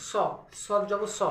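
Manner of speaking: স as a heavy, rounded "so"; thick s. Shown as a demonstration. Teacher spelling out sad with zabar, thick s. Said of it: "একটু মোটা হবে".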